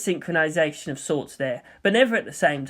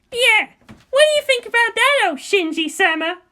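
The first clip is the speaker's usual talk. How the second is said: high, loud, mocking girl; taunting teen looming over him; Asuka's voice; 4 dB louder than usual. Asuka taunting Shinji, high and mocking.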